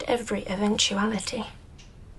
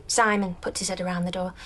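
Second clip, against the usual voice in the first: normal talking voice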